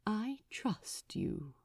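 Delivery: softly whispered, slow and tender. A narrator's reading. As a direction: breathe it to him as a secret. Tender confession holding hands.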